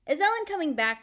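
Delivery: put-on a small girl asking eagerly; child's question. Little Kirsty asking Mama about her friend, high and eager.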